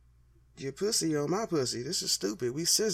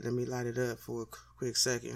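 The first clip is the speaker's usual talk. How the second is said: ordinary talk, nothing marked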